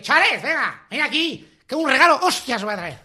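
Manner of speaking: put-on high, loud, gruff Olentzero calling a kid over; rough Olentzero voice. Comedian imagining Olentzero calling a child, high and loud. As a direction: bark it like a boozy village Olentzero. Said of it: "yo me imagino así a dolenchero".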